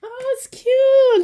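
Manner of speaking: high, loud, drawn-out coo; delighted squeal. Real, not acted. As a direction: squeal slowly over something cute. Delighted reaction to cute item.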